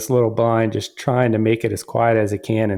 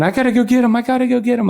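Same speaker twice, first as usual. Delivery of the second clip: high, loud, overjoyed yelling; a boy bursting with excitement after his first turkey; excited scream; 4 dB louder, much faster than usual. Retold or imitated. Son screaming to go fetch his turkey, high and loud. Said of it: "jumping up, screaming".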